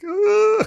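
high, loud mocking groan; a big exaggerated disgusted ugh; imitating a dismayed onlooker's high groan. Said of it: "It was like".

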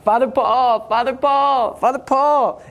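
high, stretched-out calling of a name; retold yelling out. Calling for the priest in a packed crowd, mock yell. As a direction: imitate calling to someone across a crowd. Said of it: "I kept yelling out".

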